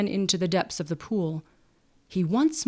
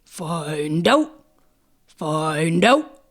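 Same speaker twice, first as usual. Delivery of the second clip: lower, gulping frog call, rising on out; bullfrog bellow; 3 semitones lower, 4 dB louder, much slower than usual. A narrator's reading. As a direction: boom it out like a big bullfrog. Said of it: "bellowed in answer".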